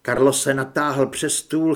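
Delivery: ordinary delivery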